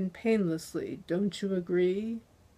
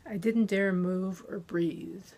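plain reading voice

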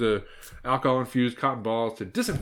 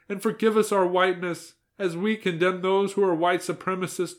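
higher, louder, fervent prayer voice; old woman praying; 9 semitones higher, 6 dB louder than usual. Acted. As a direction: pray it out with rising fervour. Grandmother recites parody prayer.

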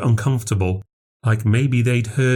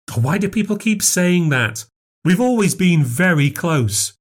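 higher, defensive Nan; indignant protest; 8 semitones higher than usual. A narrator's reading. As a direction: protest as if wrongly doubted.

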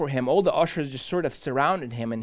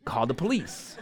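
usual speaking voice

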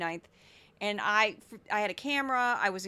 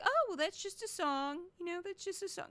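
mock-unbothered, higher-pitched and soft; casual dismissal; 6 semitones higher, 6 dB quieter than usual. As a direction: shrug it off as no big deal. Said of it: "F the Police was not like".

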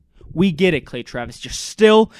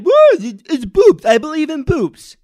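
high, loud fake surprise; mock schoolboy Clay; 9 semitones higher, 7 dB louder than usual. Imitating Clay's fake innocence on air, high and loud. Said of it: "he's trying to act".

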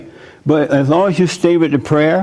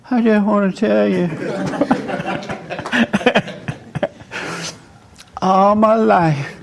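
timid and shaky; 6 semitones higher, 4 dB quieter than usual. Retold or imitated. A son afraid to confront his mother, higher and timid. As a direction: squeak it out, scared to say it. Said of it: "but I wasn't this strong".

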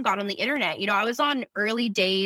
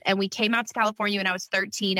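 usual speaking voice

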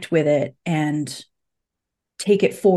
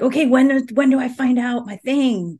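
mock impatience; 6 semitones higher than usual. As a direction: act out being eager to get there already. Voices own past impatience to arrive.

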